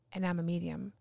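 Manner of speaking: soft, low, careful confession; emotional. Retold or imitated. Student accepting she is a medium, quietly emotional. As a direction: own it quietly, word by word. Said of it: "It was very emotional".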